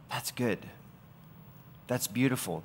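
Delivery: soft, unhurried approval; softly savoring finished work; quiet satisfaction. Acted. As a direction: step back and murmur it softly, with pauses. Acting out contentment after finished work.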